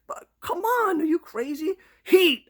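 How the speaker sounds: very high, loud disbelief; flipping out. Retold or imitated. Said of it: "I'm flipping out".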